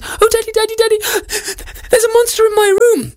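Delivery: put-on squeaky high frightened girl; tiny high voice, scared and pleading; cartoon princess. Frightened princess calls father.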